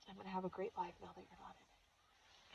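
whispered, barely voiced; hushed whisper. Real, not acted. Daughter recalling her late mother's final words, whispering. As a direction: let the words drop to a whisper.

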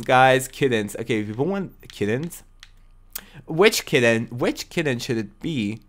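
usual speaking voice

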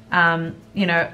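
ordinary talk, nothing marked

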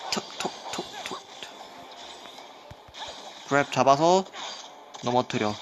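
ordinary talk

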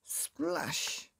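usual speaking voice

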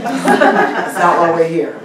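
teasing laughing dismissal. Real, not acted. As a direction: chuckle it out slowly. Laughing aside continues.

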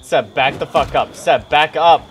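loud urgent shout. Real, not acted. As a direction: yell at him to back off. Yelling at teammate in game.

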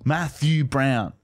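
"Matthew Brown" slow and low, split in three; three slow beats. Shown as a demonstration. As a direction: count out "Math-ew Brown" syllable by syllable. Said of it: "Everything's threes".

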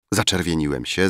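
ordinary delivery

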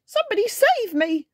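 high panicked storybook shout. A narrator's reading. Wobbling character begs to be saved, shouting. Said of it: "he shouted".

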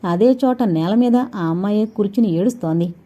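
plain reading voice

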